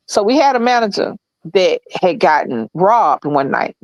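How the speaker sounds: ordinary talk, nothing marked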